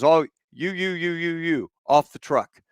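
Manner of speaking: high, rapid-fire picking out of men; commanding order. Retold or imitated. Bill orders A positive soldiers off the truck, commanding. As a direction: point them out one by one, commanding. Bill picks out blood donors.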